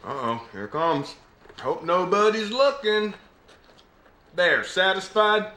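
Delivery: put-on Southern accent. Actor auditioning as a Southern clerk serving a ma'am, Southern accent. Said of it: "putting on a Southern accent".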